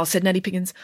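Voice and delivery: usual speaking voice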